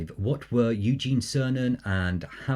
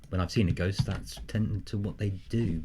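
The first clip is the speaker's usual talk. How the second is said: normal talking voice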